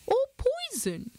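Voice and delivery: high squeak of a mouse. A narrator's reading. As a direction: chirp it like a tiny mouse. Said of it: "squeaked another".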